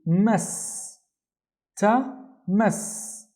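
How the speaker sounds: MAS with the S held; "mas, ta, mas" slowly, each syllable apart; syllable demo. Shown as a demonstration. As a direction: sound out the S closing "mas". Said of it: "also need to pronounce the S sound".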